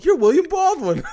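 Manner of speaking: high, laughing fan squeal; giddy high fan voice, laughing; laughing starstruck gush. Retold or imitated. Mock starstruck gushing.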